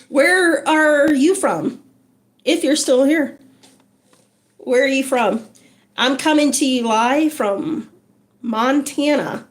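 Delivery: ordinary talk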